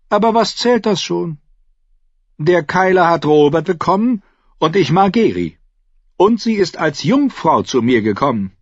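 high-voiced, boastful younger brother; Renly, smug. A narrator's reading. (hohe, selbstgefällige Stimme)

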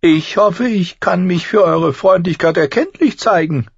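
deutlich höher und lauter, spöttischer Zwerg; Tyrion, hohe Stimme. A narrator's reading. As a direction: hell und keck sprechen, wie ein Zwerg. Tyrion bietet Dank an. (Tyrion antwortet dem Lord Commander, hoch und keck)

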